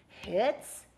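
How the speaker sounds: rising surprised question; hits, asked in surprise. Acted. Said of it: "full of surprise".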